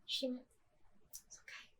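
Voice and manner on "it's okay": barely voiced, very soft "It's okay"; near-whisper. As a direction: breathe it out almost silently. Mother comforting through tears.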